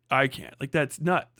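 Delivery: ordinary delivery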